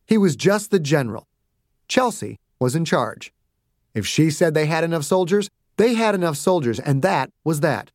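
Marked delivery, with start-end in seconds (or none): none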